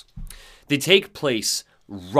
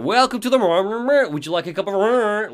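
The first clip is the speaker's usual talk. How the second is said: high-pitched, louder waitress voice breaking into garble; glitching Rose; 8 semitones higher, 4 dB louder than usual. Acted. Storyteller demonstrating how the waitress offers a drink, glitching out. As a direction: greet brightly, then glitch into mush. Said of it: "it's almost like she's glitching out".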